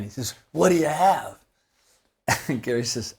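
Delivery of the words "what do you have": imitated Scotsman, higher and louder; thick Scottish accent. Scottish fan asking the bassist's order, thick Scottish accent. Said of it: "in this really thick Scottish accent".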